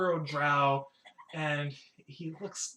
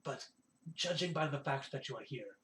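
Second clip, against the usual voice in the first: confiding innkeeper; 5 dB quieter than usual. Acted. Innkeeper reassures guest about the false rumour, confiding. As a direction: confide gently, weighing each phrase.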